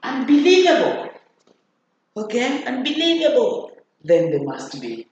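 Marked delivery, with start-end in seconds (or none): 0.0-1.1 high, loud cry of disbelief; disbelieving exclamation. Shown as a demonstration. Teacher showing where exclamation marks go, disbelieving. 2.7-3.7 high-pitched disbelief, falling at the end; disbelieving exclamation. Shown as a demonstration. Teacher repeats exclamation example to class, disbelieving.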